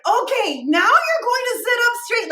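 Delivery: over-project each word as if he can't hear. Retold or imitated. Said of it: "she raised her voice".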